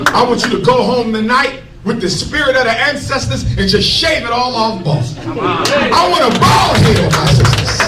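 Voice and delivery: usual speaking voice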